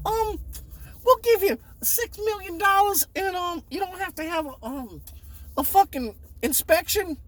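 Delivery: high, breezy mock of a rich buyer; mock eager buyer. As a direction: toss off the offer like money means nothing. Buyers' over-the-top offer mocked.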